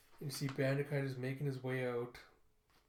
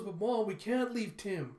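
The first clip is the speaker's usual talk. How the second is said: put-on pleading child; 7 semitones higher, 5 dB louder than usual. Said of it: "And she goes".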